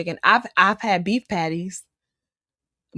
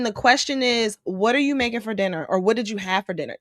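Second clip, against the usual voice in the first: usual speaking voice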